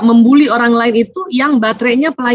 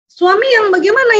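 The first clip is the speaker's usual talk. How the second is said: ordinary talk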